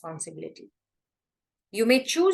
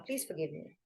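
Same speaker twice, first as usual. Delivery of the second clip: much softer, lower, bowed-head plea; humble, surrendering; 5 semitones lower, 13 dB quieter than usual. Shown as a demonstration. Teacher demonstrating surrender in prayer, soft humble plea. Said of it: "the image of surrendering and bowing your head".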